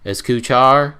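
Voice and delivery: mock a clueless American accent, questioning. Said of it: "say it with an American accent".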